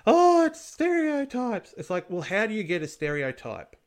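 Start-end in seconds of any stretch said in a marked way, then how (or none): 0.0-1.7 much higher, slow mocking whine; high, drawn-out sarcastic whine; mock complaint. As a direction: parody the offended critics. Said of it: "being like".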